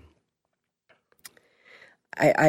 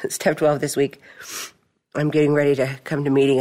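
usual speaking voice

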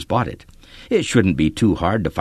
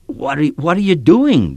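choked out; 7 semitones higher, 3 dB louder than usual. A narrator's reading. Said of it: "David choked out".

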